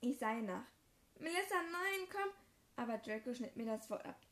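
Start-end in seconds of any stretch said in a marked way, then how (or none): 1.2-2.3 calling after her. Acted. Calling after fleeing friend.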